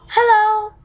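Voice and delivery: loud high hello; cheery hello. Acted. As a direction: sing out a hello. Frog at the door.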